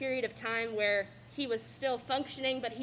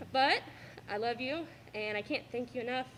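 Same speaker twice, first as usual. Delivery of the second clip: normal talking voice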